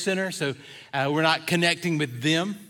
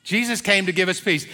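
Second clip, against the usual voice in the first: usual speaking voice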